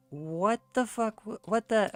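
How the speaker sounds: exasperated outburst. Retold or imitated. Scolding the stepbrother after the rescue, exasperated and loud. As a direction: blurt it out in disbelief. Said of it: "I'm like".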